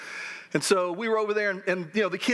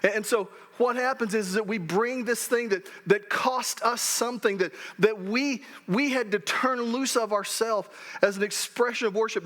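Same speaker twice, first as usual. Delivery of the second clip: ordinary talk, nothing marked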